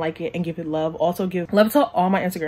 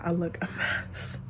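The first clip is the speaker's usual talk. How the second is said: whisper; 9 dB quieter than usual. Real, not acted. Vlogger tearing up on camera.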